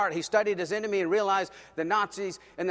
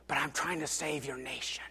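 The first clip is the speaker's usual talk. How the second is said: whispered plea; 2 semitones lower, 9 dB quieter than usual. Retold or imitated. Wallenberg's plea to the crowd.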